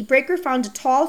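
ordinary delivery, nothing marked

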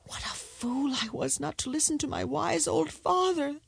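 high, soft wail of regret; rueful lament. Acted. Eldest son trapped in the dragon's belly, rueful.

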